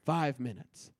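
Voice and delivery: ordinary talk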